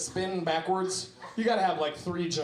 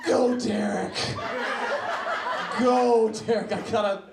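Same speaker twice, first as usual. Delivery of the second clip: laughing cheer; 4 semitones higher, 5 dB louder than usual. Real, not acted. Heckler cheering from crowd.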